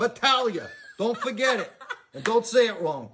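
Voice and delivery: loud, high mock-tough New York guy, laughing. Actor telling the interviewer his character's name, loud and laughing. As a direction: bark the name like a proud wiseguy, half laughing. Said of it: "I'm playing Lou Battaglia".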